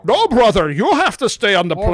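loud masked villain impression; Bane voice. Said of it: "you need more of a Sean Connery kind of lilt".

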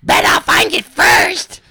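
high, drawn-out mock-girl screech; Dina. Older sister teasing her brother, shrieky girl voice. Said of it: "here, I'll do Dina".

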